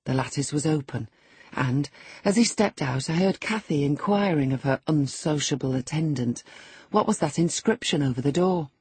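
usual speaking voice

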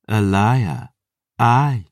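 cold, mocking predator; menacing vampire voice. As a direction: taunt your prey. Lily agrees.

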